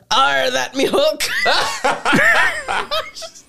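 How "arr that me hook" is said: laughing pirate voice, a bit higher; hammed-up pirate punchline. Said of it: "she goes".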